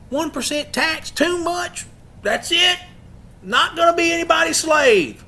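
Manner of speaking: high-pitched protesting cry, building to a shout; acted outrage. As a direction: cry out like a rebel refusing to pay. Acts out outraged colonists before revolution.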